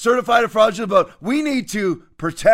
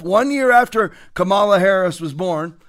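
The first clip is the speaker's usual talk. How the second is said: usual speaking voice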